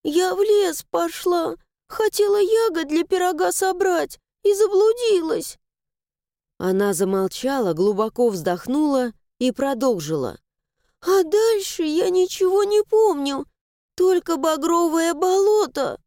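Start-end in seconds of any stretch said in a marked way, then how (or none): 0.0-5.6 frightened pleading. Acted. Frightened girl explains herself. 11.0-16.0 frightened pleading. Acted. Frightened girl continues.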